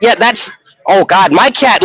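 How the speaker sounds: ordinary delivery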